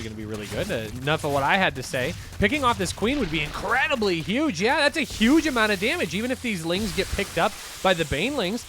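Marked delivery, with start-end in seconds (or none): 2.4-8.6 higher and louder, rising excitement over the fight; excited play-by-play. Real, not acted. Caster calling the fight for viewers, excited. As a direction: call the queen snipe like it's huge.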